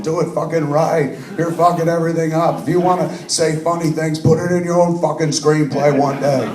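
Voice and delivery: retold angry berating, hammed up. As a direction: replays himself scolding an actor, half-laughing. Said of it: "who I've just been berating".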